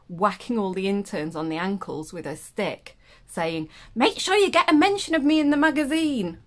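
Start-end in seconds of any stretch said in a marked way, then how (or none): 3.9-6.4 put-on loud, high German-accented order; imperious German-accented cry, pitched well up and loud; Anne Burder in a German accent. Magazine founder ordering the interns about, loud German accent. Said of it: "in a German accent".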